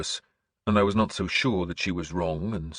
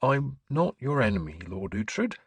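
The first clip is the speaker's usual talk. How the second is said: higher, mild-mannered churchman; gentle; 5 semitones higher than usual. A narrator's reading. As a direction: reassure him gently in a higher character voice. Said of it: "he said gently".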